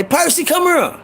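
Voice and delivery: high calling voice of a mother summoning her son; mama calling. Retold or imitated. Mama calls Percy in after seeing the ring, high summons. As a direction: call him over like a mother who already knows.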